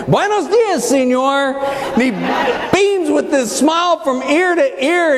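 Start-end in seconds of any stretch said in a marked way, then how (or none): 0.1-1.6 high-pitched Tex-Mex greeting; Tex-Mex accent. Retold or imitated. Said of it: "in my best Tex-Mex accent".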